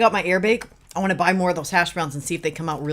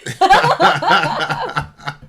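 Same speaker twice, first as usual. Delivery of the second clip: loud laugh; 8 semitones higher, 4 dB louder than usual. Real, not acted. Laughs at the meal photo.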